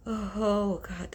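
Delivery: ordinary talk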